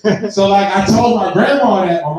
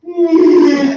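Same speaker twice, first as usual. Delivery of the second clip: put-on boy's whimper; 7 semitones higher, 7 dB louder than usual. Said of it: "he go".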